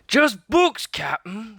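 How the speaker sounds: very high, loud call down; crewman shouting. A narrator's reading. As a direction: shout the report down. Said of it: "shouted Stinky".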